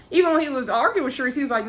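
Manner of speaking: ordinary talk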